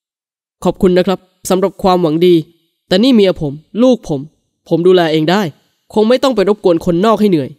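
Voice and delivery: jealous husband. A narrator's reading.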